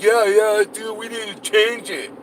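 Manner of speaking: mocking a dim follower. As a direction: play him as a gullible yes-man, lightly mocked. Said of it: "are like".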